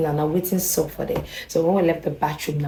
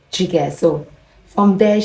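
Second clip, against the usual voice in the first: ordinary talk, nothing marked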